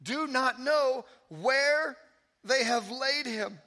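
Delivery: preacher lingering on the key words; slow emphatic reading. Shown as a demonstration. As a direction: drive the repeated phrase home slowly. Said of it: "Third time".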